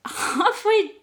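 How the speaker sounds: giggling through the words; laughing. Real, not acted. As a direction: laugh while you talk.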